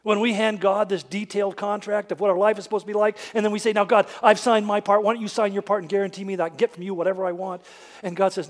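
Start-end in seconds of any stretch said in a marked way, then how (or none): 3.7-7.6 pushy, fast bargaining with God; demanding bargaining. Retold or imitated. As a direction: press God to sign. Demanding believer addresses God.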